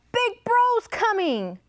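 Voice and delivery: high-pitched frog warning; high, dramatic frog call; croaking frog. A narrator's reading. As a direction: sound the alarm high as a storybook frog. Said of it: "croaked the frog".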